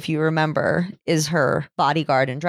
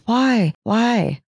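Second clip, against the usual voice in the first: higher-pitched, lightly acted plea; imitated pleading; 6 semitones higher, 3 dB louder than usual. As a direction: imitate a victim asking over and over. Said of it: "keeps saying why".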